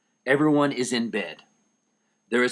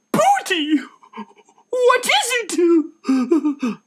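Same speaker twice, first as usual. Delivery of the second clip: high, loud, very slow wail; distraught cry; 6 dB louder, much slower than usual. Acted. Missus Van Dan seeing her husband caught, distraught wail. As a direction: wail his pet name in dismay.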